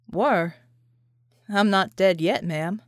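a soldier joking that he's still alive; John's voice, teasing. A narrator's reading. John teasing Vicky.

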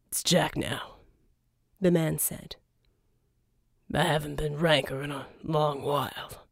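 low, soft, whispered growl; low grumble, curt and cold; gruff Jack. A narrator's reading. Jack correcting Verdigris about his name, quiet.

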